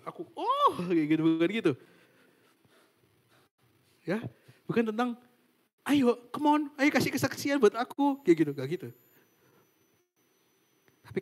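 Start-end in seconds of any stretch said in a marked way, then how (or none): 0.0-0.7 squeaky much higher mock show-off cooing for praise; mock praise-seeker. Mocking a vain server who wants to be praised, in a high squeal. 5.8-8.2 higher, louder mock show-off; mock bragging demand. Mocking a server who demands a testimony about himself, higher and louder.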